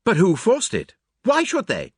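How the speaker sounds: high, loud, startled questions in bursts; disjointed exclamations. A narrator's reading. Onlookers react to forced lock, startled outbursts. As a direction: burst out with each question separately. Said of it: "These exclamations burst from us disjointedly".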